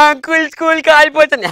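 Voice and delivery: high-pitched mock blubbering; crying child. Crying boy answering the uncle, mock wailing. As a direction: wail it out like a sobbing little boy. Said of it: "అసలు ఎందుకు ఏడుస్తున్నావు నువ్వు".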